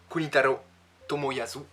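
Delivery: hammed-up Japanese accent on the names; mock Japanese accent. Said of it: "toujours avec mon accent japonais de qualité".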